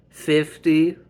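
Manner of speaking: shocked disbelief. Acted. Wife learning her husband overspent, shocked. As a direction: burst out in disbelief.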